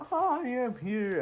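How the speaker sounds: high, projected heroic announcement; All Might. Retold or imitated. Hero appearing in the played letter, big proclaiming voice. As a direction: announce yourself like a grand hero. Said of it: "And it's always".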